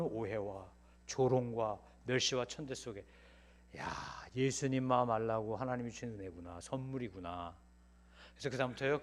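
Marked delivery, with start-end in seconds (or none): none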